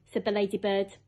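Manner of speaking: ordinary delivery, nothing marked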